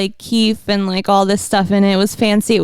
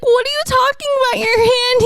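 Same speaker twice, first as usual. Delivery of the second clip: high, crying-edged whine; mock whiny disbelief; 13 semitones higher than usual. Girlfriend retelling her 3 a.m. question, high mock whine.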